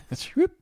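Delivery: squeaky high whoop; high whoop sound effect. Retold or imitated. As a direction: mimic a cartoon whoop. Mimicking lipstick vanishing.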